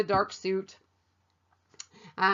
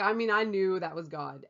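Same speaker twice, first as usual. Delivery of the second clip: ordinary talk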